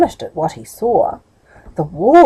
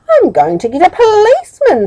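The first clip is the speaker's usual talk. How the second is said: upset; 11 semitones higher, 6 dB louder than usual. Acted. Middle thrown out, upset.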